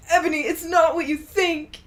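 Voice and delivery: lightly voiced desperate boyfriend; hammed-up tearful cry; sad scream. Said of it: "Draco screamed sadly".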